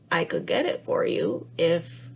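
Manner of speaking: small creature offering help; timid frog. Acted. Frog offers to fetch the ball, shy and low.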